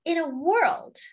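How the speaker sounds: ordinary delivery, nothing marked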